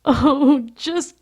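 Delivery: loud, high, drawn-out wail; anguished wail. A narrator's reading. Lewis begs to be killed, wailing. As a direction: cry it out high and slow in misery. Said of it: "he wails".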